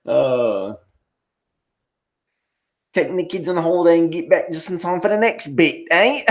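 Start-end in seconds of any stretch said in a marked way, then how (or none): 2.9-6.2 higher, jumbled fake British accent; hammed-up mongrel British accent with a tacked-on "eh"; muddled mock accents. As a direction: mash silly accents together, badly British. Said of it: "mixing all kind of fucking stupid accents together".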